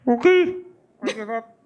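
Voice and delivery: high, desperate pleading from inside a mouth; pleading surrender. Acted. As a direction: beg to be let go, voice squeezed high. Hag begging from inside the mouth.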